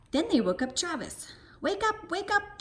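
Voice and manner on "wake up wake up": calling to wake. A narrator's reading. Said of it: "they shouted".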